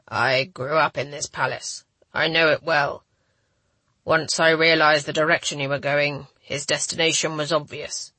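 understated low male voice; injured man. A narrator's reading. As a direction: answer as the injured hero, a touch weary. Weak injured man answers her.

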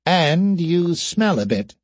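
high, drawn-out character voice; whiskered creature's retort. Acted.

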